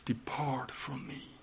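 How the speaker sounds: breathy unvoiced whisper; whispered plea. Peter whispering to Jesus in shame, whispered. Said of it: "like Peter's word".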